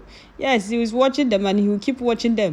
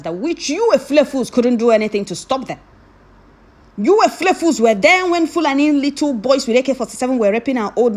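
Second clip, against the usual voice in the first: loud, high furious tirade at the critics; angry rant; 4 semitones higher, 5 dB louder than usual. Real, not acted. Ranting loudly at commenters who mocked eleven men. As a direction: blast the doubters with rising fury.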